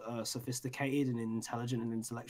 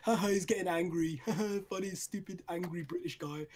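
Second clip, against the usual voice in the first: higher-pitched mocking chuckle between words; mock laughing; 4 semitones higher than usual. Imitating viewers laughing at his angry videos, mock laughing. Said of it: "people just look at my videos and go".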